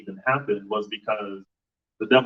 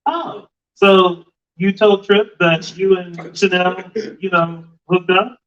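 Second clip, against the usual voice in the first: put-on offhand tattling, pitched up; mock nonchalant; 8 semitones higher, 8 dB louder than usual. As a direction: toss out gossip with exaggerated casualness. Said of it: "just nonchalantly go".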